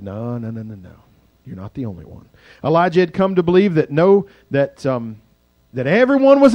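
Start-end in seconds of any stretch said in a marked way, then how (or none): 0.0-2.3 low, hushed, reassuring denial; gentle God. Acted. God quietly reassuring discouraged Elijah, hushed and gentle. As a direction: comfort him softly. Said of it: "God said".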